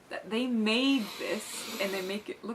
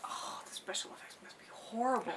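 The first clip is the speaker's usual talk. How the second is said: whisper; 2 semitones higher, 7 dB quieter than usual. Retold or imitated. Mocking people unimpressed by old film.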